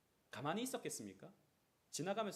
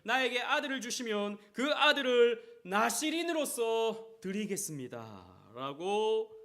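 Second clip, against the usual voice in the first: fervent vow; 7 semitones higher, 13 dB louder, slower than usual. Acted. Hannah's vow, preached loudly.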